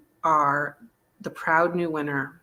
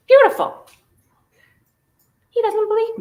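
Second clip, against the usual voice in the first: high mock outrage; 8 dB louder than usual.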